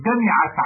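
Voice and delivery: دمعت with an i after the m, "dami'at"; kasra variant. Shown as a demonstration. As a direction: give دمعت a short i on the m. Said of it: "بكسر الميم لغة رديئة".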